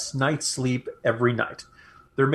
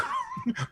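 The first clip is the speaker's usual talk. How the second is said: high giggle; 9 dB quieter than usual. Real, not acted. Laughs at own dirty secret.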